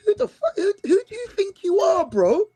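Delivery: high-pitched, laughing "who do you think you are"; laughing mock outrage. Fan retelling his reaction to Gyökeres's boast, laughing high mock outrage. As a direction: play at being scandalised, cracking up as you say it. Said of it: "even I said".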